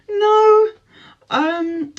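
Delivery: high-pitched, stretched no, then hesitant um; coy refusal. Retold or imitated. To the man who asked for her number, high and coy. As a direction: squeal the no playfully.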